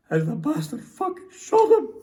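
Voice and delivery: anguished high cry, loud and breaking; losing control. Acted. Soldier recalling the prisoner's execution, losing control. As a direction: voice cracks upward in distress. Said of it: "I should have more control with this".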